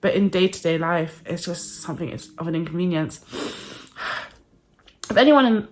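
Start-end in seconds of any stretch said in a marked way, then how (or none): 3.2-4.3 long tired sigh; heavy sigh. Real, not acted. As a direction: let out a weary sigh. Struggling to breathe, sighs.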